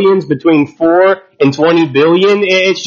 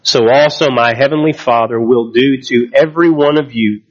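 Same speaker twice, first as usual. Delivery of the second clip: measured, lower Bible reading voice; scripture reading; 3 semitones lower than usual. Acted. Pastor reading scripture to the church, measured and low. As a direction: read the passage to the congregation, steady and measured. Pastor reads Matthew aloud.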